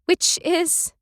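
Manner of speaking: high uneasy prompt; anxious. Acted. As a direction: nervously ask. Anxious question.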